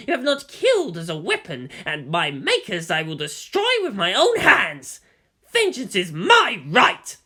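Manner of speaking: rising to a loud, furious declaration; vengeful outburst. Acted. Wronged character vowing vengeance on her makers, building angry shout.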